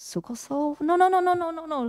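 high, rapid-fire run of mock refusals; acted rejection. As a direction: echo the name, then reject it in a high string of no's. Acting out rejection of the name. (แกล้งปฏิเสธรัวๆ เสียงสูง)

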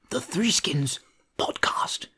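breathy nature-show whisper; hushed whisper. Retold or imitated. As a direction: hush it like a wildlife documentary.

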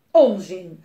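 loud, curt dismissal; calling out. A narrator's reading. Papa overrules the twins, calling out. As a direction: shout it down as a cross father. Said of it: "riep papa".

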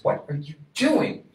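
startled question. A narrator's reading. Onlooking parent asks the digging father, incredulous. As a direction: call out in disbelief.

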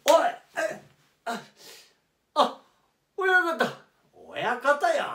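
loud, high startled cry; startled waking. Acted. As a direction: jolt awake with a yell.

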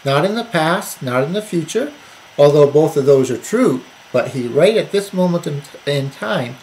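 ordinary delivery

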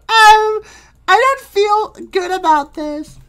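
very high, slow, worried cartoon voice; uneasy Screen Lady. Screen Lady worries about cooking, high uneasy voice.

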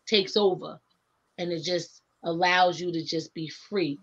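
usual speaking voice

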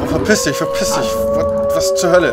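panicked screaming. Real, not acted. Panicked gamer attacked by monster.

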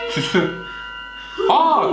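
normal talking voice

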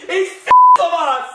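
loud, high outburst of real disgust; angry yell. Real, not acted. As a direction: spit it out fed up and furious. Wut über Auswechslung. (lauter, hoher Wutausbruch)

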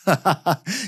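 short breathy chuckle. Real, not acted. Host amused at his own guest booking, chuckling. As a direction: laugh briefly to yourself before answering. Amused pause before answering.

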